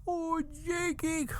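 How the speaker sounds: high, slow fake-grief wail; hammed-up bereaved moan; mock crying. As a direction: sob the name out long and high. Reprise of acted crying.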